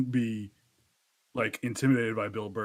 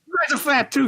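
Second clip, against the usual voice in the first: mock Bill Burr; 9 dB louder, faster than usual. Echoing the Bill Burr bit.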